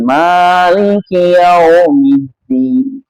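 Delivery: "Maliki yaumiddin" very slow, each long vowel swung gently; "Maliki yaumiddin" as unhurried, lilting recitation; gentle swaying recitation. Shown as a demonstration. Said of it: "harusnya dibaca lembut. Yau, mengayun".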